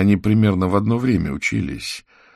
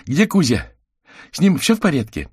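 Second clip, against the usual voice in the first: put-on high, louder child's voice; eager child; 11 semitones higher, 5 dB louder than usual. Misha asks if Kuzya is all right, eager high child's voice. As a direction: ask urgently in a raised, childlike pitch.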